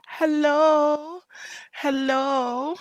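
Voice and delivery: high, rising cartoonish hello; sing-song hello. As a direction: chirp a bright greeting to each viewer. Sing-song greeting shout-outs.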